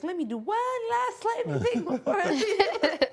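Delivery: high, slow, laughing mock quote. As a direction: giggle through the quote. Mocking Marty, laughing.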